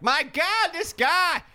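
very high, exasperated exclamation; hammed-up outraged squeal; Lindsey Graham outrage. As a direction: throw up your hands in shrill disbelief. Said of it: "Lindsey Graham's like".

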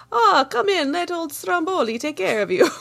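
put-on a jolly host welcoming you in, in a cheesy Italian accent; terrible Italian accent. Host cheerfully inviting guests in, mock Italian accent. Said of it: "terrible Italian accent".

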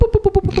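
high Zoidberg-like whoops; crab whoop. Acted. Littlest crab dragging a corpse away, high whooping. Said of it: "you hear".

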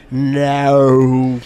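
"No" mimicked loud with a long, gliding O; extra O's in No. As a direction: stretch "No" as if it had several O's. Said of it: "a couple extra O's in your no".

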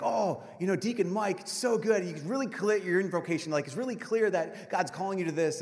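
high, mock-gushing praise; lightly voiced proud family. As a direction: imitate relatives raving after Mass. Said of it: "family from friends were like".

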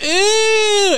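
squealed high disgusted ew; mock kid's disgusted "ew". Kids reacting to a fart, squealing ew. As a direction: shriek it like a grossed-out kid.

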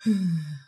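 long, controlled exhale; one calm breath. Retold or imitated. Storyteller reenacting her breath before going under, calm exhale. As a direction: demonstrate a single steadying breath. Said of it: "just one calm breath".

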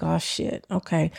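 very soft, under-the-breath curse; muttered to herself. Retold or imitated. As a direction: mutter it like a private letdown. Finds salon still closed.

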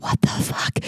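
whispered in total disbelief; stunned whisper. Retold or imitated. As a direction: breathe it out, shocked. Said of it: "We were like".

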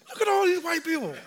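high, laughing closed-mouth whisper-talk; talking without opening mouth. Retold or imitated. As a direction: say it through barely open lips. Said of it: "you talk without opening your mouth".

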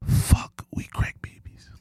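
dismayed whisper. Real, not acted. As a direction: breathe it out in a whisper. Dismayed realization.

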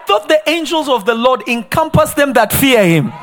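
loud, high shouting sermon; fiery preaching. Acted. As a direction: preach it hard to the congregation. Preaching at full force.

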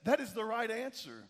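high-pitched congratulation, easing down at the end; celebrating. Acted. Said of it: "he's celebrating".

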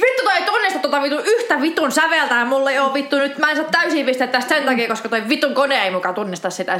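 angry rant. Acted. Musician blaming the practice app for mistakes, exasperated rant. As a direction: let the swearing tumble out in outrage. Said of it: "joinain päivinä vaan silleen".

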